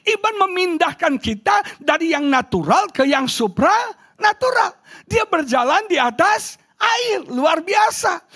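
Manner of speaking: high-pitched proclamation of faith; fervent preaching. Acted. Preacher tells congregation of walking on water, fervent high preaching.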